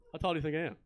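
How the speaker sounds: ordinary talk, nothing marked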